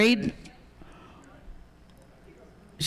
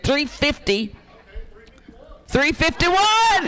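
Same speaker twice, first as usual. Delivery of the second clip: calling out the bid; 8 semitones higher, slower than usual. Real, not acted. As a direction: ring out the new bid over the room.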